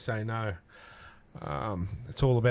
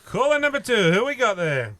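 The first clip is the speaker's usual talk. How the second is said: announcer voice; 8 semitones higher, 5 dB louder than usual. Real, not acted. Radio host introduces next caller.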